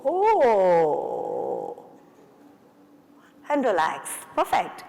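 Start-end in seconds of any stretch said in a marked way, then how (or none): 0.0-1.9 long oh with jaw dropped. Shown as a demonstration. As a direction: let the oh fall slowly. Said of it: "One more time".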